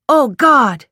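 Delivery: sudden loud, high alarmed cry. A narrator's reading. Car stalls in traffic.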